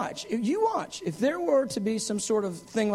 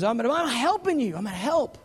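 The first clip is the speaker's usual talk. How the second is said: eager reassurance; 6 semitones higher than usual. Retold or imitated. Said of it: "He goes".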